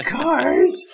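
loud, very high whiny Predator imitation; mock a whiny, high-pitched creature. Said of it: "whiny high-pitched voice".

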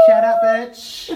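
high, slow sassy snap; drawn-out high mock insult; quoting Eden. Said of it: "To quote my friend Eden".

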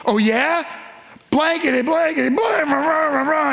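high, shouted stand-in curses; hammed-up swearing rant, words blanked out; mock cursing shout. Peter cursing at bystanders, a hammed-up shouted rant. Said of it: "he swore to them".

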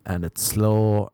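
normal talking voice

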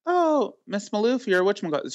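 welcoming staff voice. Retold or imitated. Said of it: "and they're like".

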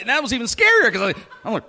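normal talking voice

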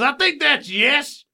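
high, slow mock-dad voice; drawn-out, high acted reply from dad; mimicked dad. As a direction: play the dim father deciding, slowly. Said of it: "And he goes".

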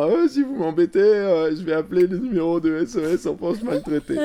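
put-on high, loud whiny child threat; sassy child blackmailing parents; bratty kid threat. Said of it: "en disant".

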